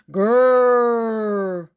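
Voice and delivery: loud growling roar like a big cat; rumbling beast growl, loud; tiger growl. Acted. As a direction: roar it out to scare the boy. Loud scary noise from tigers.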